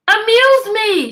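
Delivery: a bored queen ordering her jester about; commanding queen. Acted. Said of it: "she commanded".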